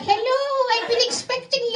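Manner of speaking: creepy gatekeeper greeting; creepy keymaster. Retold or imitated. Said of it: "there's this beady guy's like".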